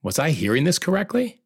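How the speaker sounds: high, rising incredulous question; stunned disbelief. Retold or imitated. Husband doubting he heard his wife correctly, stunned disbelief. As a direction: lift it right up in amazed doubt. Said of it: "I felt stunned".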